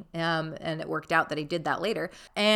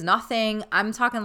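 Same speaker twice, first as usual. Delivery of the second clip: usual speaking voice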